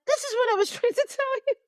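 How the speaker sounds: high, laughing quote of her own words; laughing exasperation. Retold or imitated. Retelling her pharmacy call to a friend, high and laughing. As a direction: laugh through the told-you-so line.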